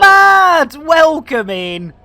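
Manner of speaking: loud high greeting shout; calling out. Real, not acted. Streamer hails a newly arrived viewer with a loud call.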